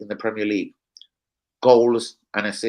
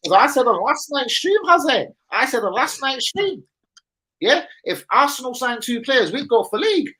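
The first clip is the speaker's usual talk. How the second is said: much higher put-on Geordie accent; 12 semitones higher than usual. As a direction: keep the high Geordie voice going. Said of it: "forget now the accents".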